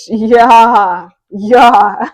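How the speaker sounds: loud laughing yeahs. Real, not acted. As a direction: laugh along at your own joke. Laughing at her own sass.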